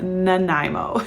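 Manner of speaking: "Nanaimo" said slowly as nuh-NYE-mo, lifting at the end; pronunciation demo. Shown as a demonstration. Canadian vlogger showing how to pronounce it, slow nuh-NYE-mo for "Nanaimo". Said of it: "that is how you pronounce it".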